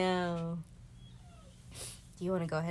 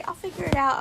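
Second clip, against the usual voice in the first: mock quote; 11 semitones higher, 10 dB louder than usual. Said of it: "As soon as they say".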